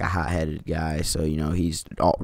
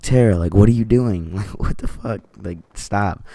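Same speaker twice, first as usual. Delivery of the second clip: mock yell, raised and louder at first; retold yelling; 3 semitones higher, 6 dB louder than usual. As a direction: call out in disbelief, then ease off. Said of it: "so I'm yell over".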